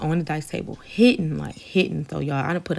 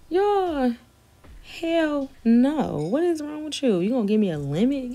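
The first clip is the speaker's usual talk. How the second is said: outraged; 8 semitones higher, slower than usual. Retold or imitated. Telling off a cheap guy, high and outraged. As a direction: let the outrage fly at him.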